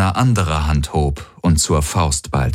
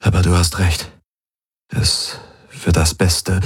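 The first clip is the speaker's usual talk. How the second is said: feigned melancholy. A narrator's reading. Galen agrees to Ylana's decision, feigned melancholy. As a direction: drag it out with pretended reluctance. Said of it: "sagte er mit geheuchelter Schwermut".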